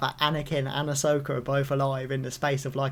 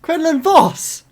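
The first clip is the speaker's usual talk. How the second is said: loud, high-pitched fan delight; real excited outburst; 8 semitones higher, 10 dB louder than usual. Real, not acted. Fan learning Quinlan Voss still lives, loud excited outburst. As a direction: exclaim it, thrilled and much louder. Hears a favourite character named.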